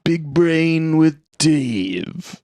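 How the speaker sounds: very slow, stretched syllables; drawn-out show name. Acted. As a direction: drag the title out slowly.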